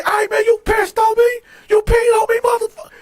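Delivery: very high, incredulous yell; outraged accusation. Retold or imitated. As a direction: re-enact furious disbelief at the cellmate. Retold angry accusation at cellmate.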